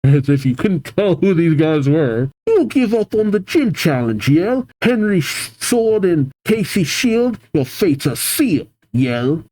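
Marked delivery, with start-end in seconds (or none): 2.5-9.4 lightly voiced taunting female grunt, pitched up; a cocky villain sneering at the kids. Team Yell grunt taunting the two trainers, cocky.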